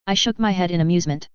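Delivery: ordinary delivery, nothing marked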